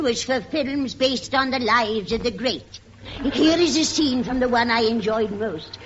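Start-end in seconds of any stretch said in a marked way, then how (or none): none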